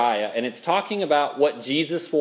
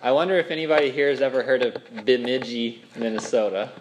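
usual speaking voice

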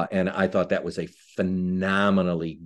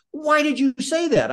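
much higher, urgent question; alarmed umpire; 4 dB louder than usual. Retold or imitated. Umpire to referee after the wrong call, high urgent question. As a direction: press him hard, stunned by the blunder. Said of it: "my umpire runs up to me and he says".

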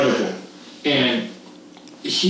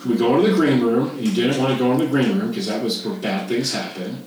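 ordinary delivery

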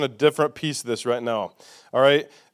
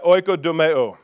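ordinary delivery

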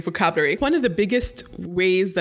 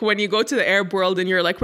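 usual speaking voice

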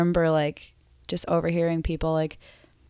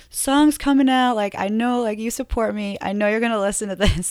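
louder, higher, heated retelling; retold rant to the owner; 4 semitones higher, 6 dB louder than usual. Musician retelling her message to the coffee shop owner, heated and louder. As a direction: tell the boss off, fired up. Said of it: "I was like".

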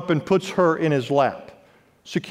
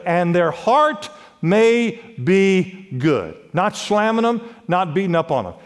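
ordinary talk, nothing marked

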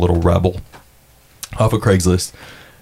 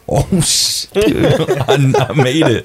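excited; 7 semitones higher, slower than usual. Retold or imitated. Retold joy at his pine cone photo.

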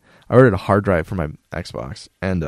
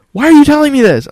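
much higher, louder disbelieving dad; incredulous dad; 9 dB louder than usual. Retold or imitated. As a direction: shout it like a baffled father. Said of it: "He goes".

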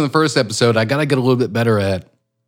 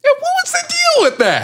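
mock interviewer.

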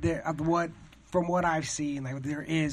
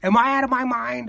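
high, louder anxious question; mock panic; 7 semitones higher, 6 dB louder than usual. Comedian doubting himself, high mock panic. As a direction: doubt your own sanity out loud. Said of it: "it's like".

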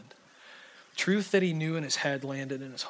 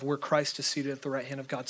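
ordinary delivery

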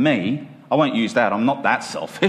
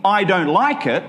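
whiny complaint; 6 semitones higher than usual. Acted. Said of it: "stomping my feet and saying".

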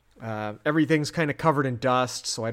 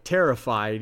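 usual speaking voice

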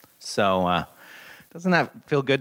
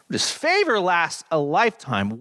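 higher, ringing stress on favor; fervent psalm proclamation; 6 semitones higher than usual. Acted. Pastor proclaiming the psalm's promise, ringing and lifted. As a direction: proclaim favor as good news. Psalm reading, praise passage.

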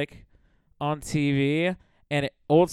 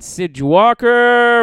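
louder, higher, drawn-out name; hyped introduction; 7 semitones higher, 11 dB louder, much slower than usual. Real, not acted. Comedian plugging the show's guests, hyped announcer call. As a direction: announce it like a headliner. Hyping lineup in show plug.

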